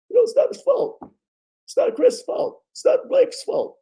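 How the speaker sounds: very high, strained coach voice fading in and out of a whisper; Doc Rivers impression. Vlogger imitates Doc Rivers blaming nobody, very high and strained. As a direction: pitch it way up like a hoarse coach excusing his players. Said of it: "That's Doc Rivers".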